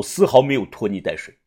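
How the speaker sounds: plain reading voice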